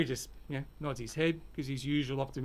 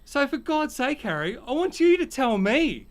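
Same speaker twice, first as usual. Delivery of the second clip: exasperated rant; 7 semitones higher, 5 dB louder than usual. Acted. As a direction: lose patience and let it build. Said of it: "Harry's just had enough".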